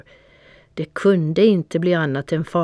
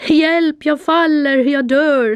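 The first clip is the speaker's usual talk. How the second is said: much higher and louder, a terrified cry; panicked scream; 11 semitones higher, 6 dB louder than usual. A narrator's reading. The cat falling outside the window, panicked scream. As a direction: scream for help as you fall. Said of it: "skrek jag i himmelens sky".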